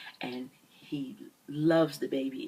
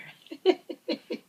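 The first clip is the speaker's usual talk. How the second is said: a short, high, breathy laugh breaking into the story; light laugh; 13 semitones higher, 4 dB louder than usual. Real, not acted.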